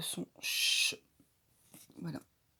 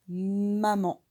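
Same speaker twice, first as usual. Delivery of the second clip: usual speaking voice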